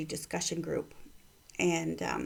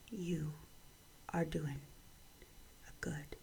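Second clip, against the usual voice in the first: one soft phrase at a time, with long pauses; tender reassurance; 3 semitones lower, 13 dB quieter than usual. Real, not acted. Speaker reassures a friend face to face, hushed and tender. Face held, affirming the listener.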